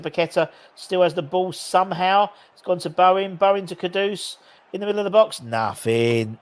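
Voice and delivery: ordinary talk, nothing marked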